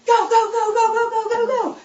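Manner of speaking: loud, high urging yell; coach's shout. Shown as a demonstration. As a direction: drive them into the sprint. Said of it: "what are you gonna do?".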